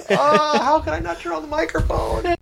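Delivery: high-pitched wail of self-reproach; exaggerated, laughing anguish; mock lament. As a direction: moan it like a hammed-up tragedy. Mock lament over own mistake.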